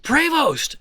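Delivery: thrilled outburst in a hushed crowd; excited shout. Retold or imitated. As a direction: blurt the name out in delight. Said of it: "I said".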